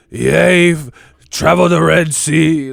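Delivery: loud, slow grand documentary voice; hammed-up old-timey narrator; mock epic narrator. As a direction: proclaim it like an ancient explorer. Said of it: "sound like a narrator to National Geographic".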